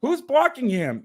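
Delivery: high, slow, frustrated question; exasperated outburst. Real, not acted. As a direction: demand an answer from the screen. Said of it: "I, I'm getting mad now".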